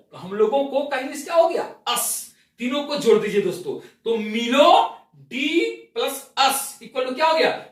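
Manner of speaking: ordinary talk, nothing marked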